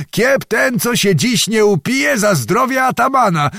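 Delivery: high-pitched rousing shout; calling out. A narrator's reading. Zagłoba egging drunken Cossacks on to barrels and girls, calling out. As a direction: whip the revellers up, voice pitched high. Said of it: "zawołał".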